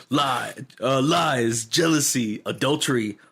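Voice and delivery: lightly voiced trailer announcer; movie trailer voice. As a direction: list the scandals like teasers in a promo. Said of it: "movie trailers".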